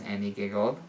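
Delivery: plain reading voice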